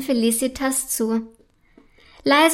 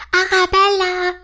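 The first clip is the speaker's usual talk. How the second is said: usual speaking voice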